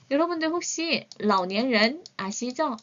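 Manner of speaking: ordinary talk, nothing marked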